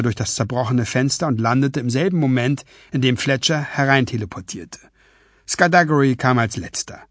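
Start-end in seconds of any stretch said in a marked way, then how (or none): none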